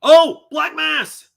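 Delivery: high, loud, drawn-out cry of delight; excited shout. Real, not acted. Streamer spotting a favorite wrestler, thrilled shout. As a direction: yell out the name, thrilled and slow.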